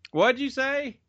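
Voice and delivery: high, drawn-out call across the house. Real, not acted. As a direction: call slowly to someone out of the room. Calls to someone off mic.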